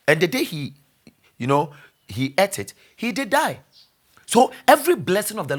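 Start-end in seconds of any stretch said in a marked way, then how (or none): none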